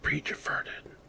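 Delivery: ordinary delivery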